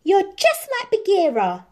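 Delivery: put-on a boy yelling at his protector; hammed-up child shout. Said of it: "shouted Mowgli".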